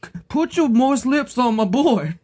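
high, hammy peasant farmer voice; ancient Greek voice. Retold or imitated. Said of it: "my ancient Greek voice".

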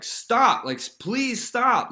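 high, loud begging to stop; a kid pinned down, begging them to stop, shrill and loud; desperate plea. Retold or imitated. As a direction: cry out for it to stop, panicked. Pinned down, begging Jamie's dad.

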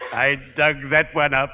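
ordinary talk

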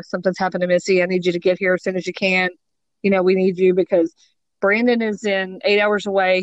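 ordinary talk, nothing marked

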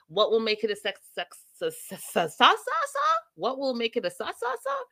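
hammed-up stuttering businessman; mock stammer. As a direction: stumble over the word sa- again and again, squeaking up. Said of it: "He's like".

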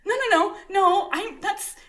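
high, panicky mock denial; flustered pleading. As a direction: imitate a mortified newcomer pleading innocence. Retold flustered denial before colleagues.

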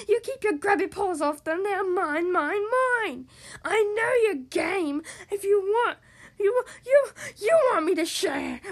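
a greedy pug flipping his wig, near tears; loud whiny tantrum voice; Pig's tantrum. Acted. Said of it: "Pig flipped his wig".

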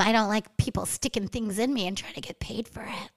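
a whispered aside. Real, not acted. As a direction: drop to a breathy whisper. Whispered confession.

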